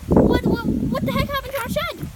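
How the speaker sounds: high, loud shocked cry; disbelieving shout. Real, not acted. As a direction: shout in disbelief that the shed is gone. Discovers shed gone.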